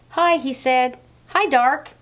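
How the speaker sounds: put-on high, bright little hello, a boy peeking at the dark; a boy greets the dark in the basement, high timid hello; small boy's voice. Laszlo greets the dark again.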